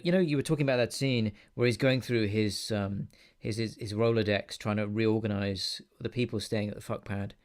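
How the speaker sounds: ordinary talk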